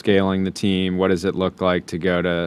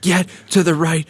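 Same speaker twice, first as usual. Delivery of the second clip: imitated yelling; 10 semitones higher than usual. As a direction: mimic coordinators yelling an order. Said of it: "just, like, yelling them".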